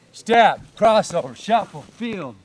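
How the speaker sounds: drawn-out, loud step calls; slow demo count. Shown as a demonstration. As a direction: call out each step slowly. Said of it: "Wanna have some fun with it?".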